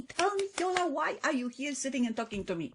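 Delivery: high, loud voice of an impatient listener; mock challenge from the audience; demanding listener. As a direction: demand it like a bored audience member. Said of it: "people always wanna know what's in it for me".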